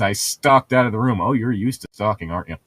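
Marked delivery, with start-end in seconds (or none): none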